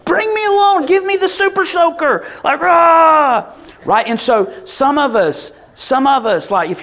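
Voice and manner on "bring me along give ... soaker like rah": high, loud pumped-up volunteer; fired-up kid asking to join the charge; eager shout. Retold or imitated. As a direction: beg to be taken along, fired up. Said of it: "you're like".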